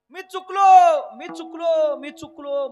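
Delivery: loud, drawn-out wail that fades over the repeats; wailing shout. Retold or imitated. Lost man wails in the market that he is lost. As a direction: cry out in despair, slowly, then let it die down. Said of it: "मोठ्यानं ओरडायला लागला आणि रडायला लागला".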